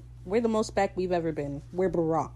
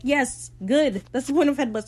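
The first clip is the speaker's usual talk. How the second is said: loud, high, emphatic retort; mock exasperation; 6 semitones higher, 6 dB louder than usual. Retort to the headbutt complaint, exasperated. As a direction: act out a heated comeback.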